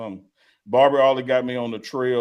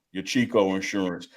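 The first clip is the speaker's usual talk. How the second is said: usual speaking voice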